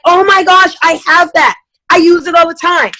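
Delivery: high, gushing imitation of a fan; enthusiastic guest. Happy customer who owns the product, excitedly imitated. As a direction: gush about it, pitched well up. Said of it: "But the person that's like".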